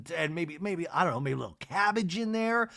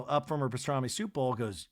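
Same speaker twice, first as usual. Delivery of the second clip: ordinary delivery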